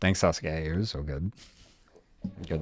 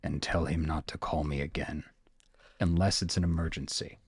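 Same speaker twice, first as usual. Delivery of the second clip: low, soft, lightly voiced sheriff; stern sheriff; 6 semitones lower, 7 dB quieter than usual. Sheriff on the phone forbidding further calls, low and stern. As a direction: lay down the rule quietly and firmly. Game master plays the sheriff.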